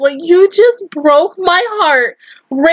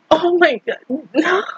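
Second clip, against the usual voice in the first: ordinary delivery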